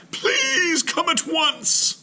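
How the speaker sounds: very high, slow fake-urgent plea; pleading old lady. Missus Wilkins pleads with Mister Klug, high and slow. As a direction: beg him slowly in mock alarm.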